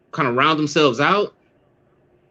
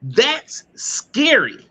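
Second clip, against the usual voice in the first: higher, drawn-out slow mock fear; mock scared; 9 semitones higher, much slower than usual. Real, not acted. Reacting to the guest's story, mock-scared and slow.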